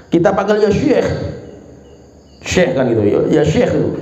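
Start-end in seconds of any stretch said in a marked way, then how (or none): none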